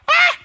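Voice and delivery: short, high mock scream; imitated frightened shriek. Husband imitating wife seeing a lizard, mock scream. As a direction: mimic a startled shriek. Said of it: "she's like".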